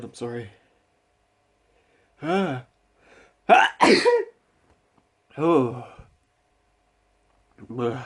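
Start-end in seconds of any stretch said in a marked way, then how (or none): 3.5-4.3 loud real sneeze; sudden violent sneeze; sneezing. Real, not acted. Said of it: "I'm gonna sneeze again".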